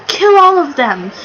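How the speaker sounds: lightly acted order to attack; Horty. Fan retelling Horty's order to the Kraken, mock command. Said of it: "when Horty was like".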